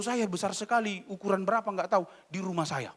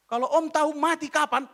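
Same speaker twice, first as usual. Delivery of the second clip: raised, higher retort to the uncle; loud retort; 7 semitones higher, 5 dB louder than usual. Retold or imitated. Preacher retorting to an uncle, loud and raised. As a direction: push back hard at the uncle.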